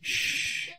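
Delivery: long airy "shh"; shushing. Acted. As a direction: hush someone who is struggling. Hushing a captive.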